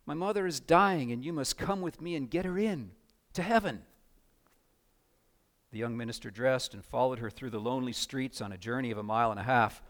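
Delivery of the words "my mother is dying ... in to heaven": higher, urgent pleading; insistent girl. A narrator's reading. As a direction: voice a little girl insisting her mother needs help. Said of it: "insisted the girl".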